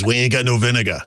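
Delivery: lightly voiced Boston clerk, flatly repeating; a hint of a blunt Boston shop guy. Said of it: "He says".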